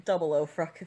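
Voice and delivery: ordinary talk, nothing marked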